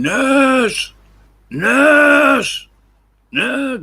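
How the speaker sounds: huge high bellow calling the nurse; old man bawling for the nurse; foghorn shout. Retold or imitated. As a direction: bellow it down the ward, very high and loud. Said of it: "foghorn voice, shouting".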